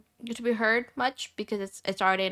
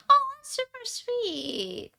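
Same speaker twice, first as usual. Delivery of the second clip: high, slow, drawn-out oh of delight; flustered high gush; touched squeal; much slower than usual. Real, not acted. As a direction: melt at the compliment, voice jumping up high. Touched by a compliment.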